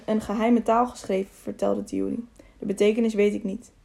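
ordinary delivery, nothing marked

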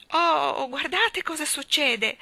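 high, loud alarmed cry on the verge of tears; near-tearful cry. A narrator's reading. Michael crying out to everyone as the moon deflates, near tears. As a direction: cry out as the moon shrinks away. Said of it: "gridò Michael e quasi piangeva".

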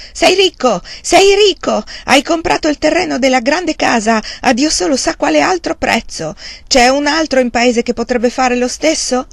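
high, loud, piercing accusation; shrill voice. A narrator's reading. Uncle shrilly accuses nephew of hiding wealth, shrill. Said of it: "con voce stridula".

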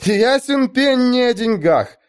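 very high, slow exclamation; exclaiming. Acted. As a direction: exclaim it as obvious. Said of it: "воскликнула Эста".

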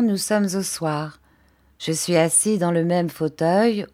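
plain reading voice

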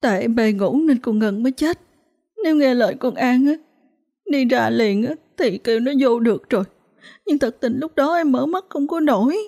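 high, tearful, pleading voice; sobbing confession. A narrator's reading. Grieving mother confessing her fault, tearful and high. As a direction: blame yourself through tears, pitched high. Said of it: "bác gái khóc nức nở".

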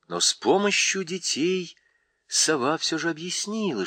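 ordinary delivery

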